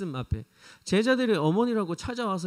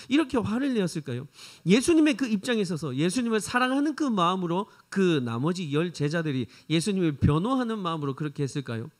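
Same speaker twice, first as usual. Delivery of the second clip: ordinary delivery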